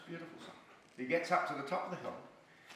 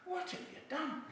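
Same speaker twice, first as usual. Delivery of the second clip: acted dismay, high and hushed; mildly hammed-up high-pitched disbelief; aghast friend; 12 semitones higher, 8 dB quieter than usual. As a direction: scold a mate in high, soft dismay. Mate aghast at Paddy's wasted wish.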